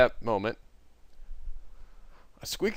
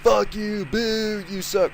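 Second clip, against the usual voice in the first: heckling; 10 semitones higher, 5 dB louder, much slower than usual. Acted. Heckling from crowd.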